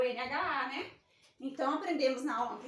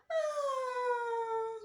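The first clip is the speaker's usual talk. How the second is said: mock crying; 7 semitones higher than usual. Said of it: "quase chorando".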